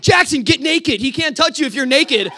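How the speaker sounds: loud, high-pitched frantic urging; retold urgent shout. Boy urges grabbed bunkmate to escape, retold frantic shout. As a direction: shout it up high like an emergency tip.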